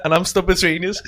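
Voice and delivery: words squeezed between bursts of laughter; helplessly amused complaint; laughing speech. Real, not acted. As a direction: say it while cracking up. Cracking up at the scene described.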